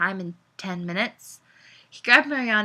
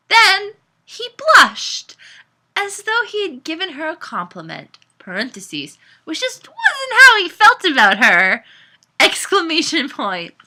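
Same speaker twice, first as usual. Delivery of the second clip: laughing; 8 semitones higher, 9 dB louder than usual. Real, not acted. Giggling while reading.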